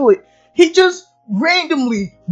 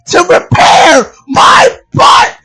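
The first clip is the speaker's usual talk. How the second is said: exasperated yell; 3 semitones higher, 6 dB louder than usual. Real, not acted. Comic rant climax.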